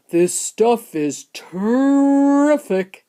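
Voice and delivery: slow, loud relish; long-stretched, blissful exclamation; savouring boy. A narrator's reading. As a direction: draw it out with greedy delight. Greedy boy savours the chocolate.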